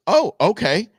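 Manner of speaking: high, loud, caught-off-guard reply; mock surprise. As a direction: act as if you didn't expect it. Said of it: "As if he didn't expect".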